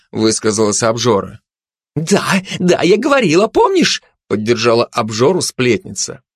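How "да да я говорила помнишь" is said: high, excited gossip voice; high-pitched insistent backing; eager agreement. Acted. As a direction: chime in eagerly, pitch climbing.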